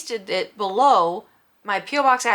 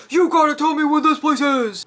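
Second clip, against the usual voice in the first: imitated rude customer, pitched higher and louder; mock angry demand; 6 semitones higher, 6 dB louder than usual. Imagining herself storming up to the clerk, hammed-up angry demand. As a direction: play the pushy customer she wasn't, loud and high. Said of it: "I didn't run up to her and was like".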